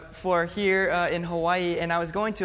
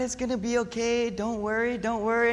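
mock soothing; 3 semitones higher than usual. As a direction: gently settle a scared stranger. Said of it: "I was trying to calm her down".

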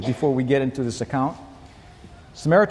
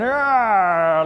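drawn-out flexing roar; mock bodybuilder roar; muscleman's growl; 3 semitones higher, 3 dB louder than usual. Shown as a demonstration. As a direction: growl as if showing off a muscle pose. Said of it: "He went".

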